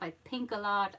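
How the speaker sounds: normal talking voice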